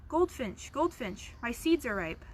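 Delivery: normal talking voice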